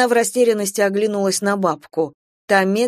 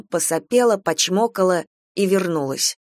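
plain reading voice

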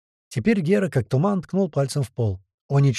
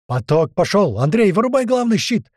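loud, rising alarmed shout; terrible scream; 8 semitones higher, 5 dB louder than usual. A narrator's reading. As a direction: scream a desperate order at a colleague. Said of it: "страшно закричал парнишка". (отчаянный громкий крик)

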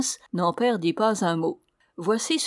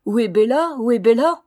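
imitating the bark; 3 semitones higher, 8 dB louder than usual. A narrator's reading. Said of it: "ne dirait-on pas qu'il dit".

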